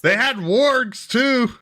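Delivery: high-pitched, drawn-out goblin-ish report; high mock NPC voice. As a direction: stretch "wargs" slowly and high.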